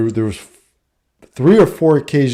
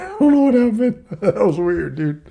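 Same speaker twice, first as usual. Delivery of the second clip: laughing through the words, higher; real embarrassed laugh; 7 semitones higher, 3 dB quieter than usual. Real, not acted. Laughing off his own odd slip to the audience. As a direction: giggle it off like it just slipped out.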